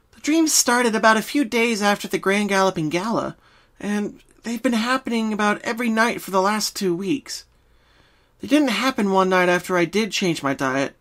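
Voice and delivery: high, bouncy pony friend; Pinky voice. Acted. Pinky starts explaining her dreams. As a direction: answer like a cheerful pink pony.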